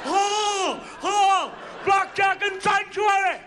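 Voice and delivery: high, loud theatrical holler; big high-pitched yell; hammed-up wail. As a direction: cry out like a mock Quasimodo. Hammed-up yell at blackjack table.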